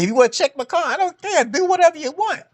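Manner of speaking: high, overly accommodating voice; eager to please. Retold or imitated. Man tells the cops to search his car, high and eager. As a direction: pitch it way up to sound harmless.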